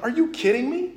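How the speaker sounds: high, rising disbelief; retold incredulity. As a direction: imitate an indignant, incredulous question. Retold reaction to being punched.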